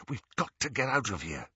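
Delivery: quick, soft, urgent whisper. A narrator's reading. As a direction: hurry them along in a hush. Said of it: "Harry whispered".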